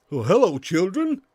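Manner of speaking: slow put-on cartoon voice; cartoon character greeting. Character greeting the children, put-on slow voice. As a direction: draw out a cartoon hello.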